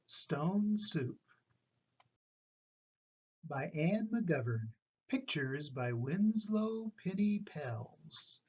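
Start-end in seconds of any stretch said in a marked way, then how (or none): none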